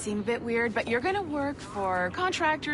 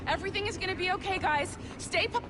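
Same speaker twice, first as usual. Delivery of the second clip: fire drill instructions; 6 semitones higher than usual. Acted. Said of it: "Just like we practiced in our safety drills".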